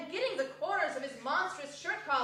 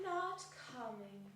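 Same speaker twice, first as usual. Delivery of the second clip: much softer, low teasing; sly hush; 4 semitones lower, 11 dB quieter than usual. Acted. Mrs Cratchit teases Bob quietly about Martha. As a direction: fib it quietly. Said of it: "said Missus Cratchit".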